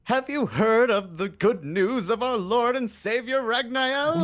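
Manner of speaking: high, slow, loud preacher pitch; hammed-up missionary at the door. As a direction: sell the good news like a zealous evangelist. Player in preacher role at door.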